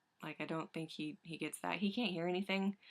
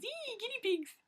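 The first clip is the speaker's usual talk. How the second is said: excited squeal. Real, not acted. Excited announcing guinea pigs.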